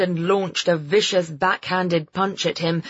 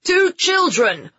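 high, slow, loud call; shouting; 13 semitones higher, 5 dB louder than usual. Acted. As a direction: call it out across the field. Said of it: "one of them shouted".

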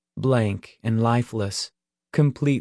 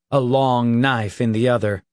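usual speaking voice

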